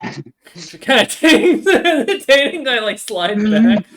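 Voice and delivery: high, slow, giggling through the words; can barely get it out for laughing; laughing narration. Real, not acted. GM cracking up while describing the creature.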